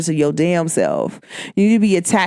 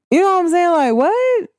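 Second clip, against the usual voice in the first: mock puzzled; 10 semitones higher than usual. Mock confused reaction.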